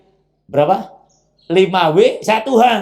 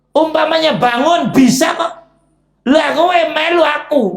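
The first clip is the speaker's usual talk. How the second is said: higher, louder mock shout; Iblis gloating; 9 semitones higher, 5 dB louder than usual. Iblis gloating at the sleeper who skipped prayer. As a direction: crow over the one who followed. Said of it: "Kata iblis".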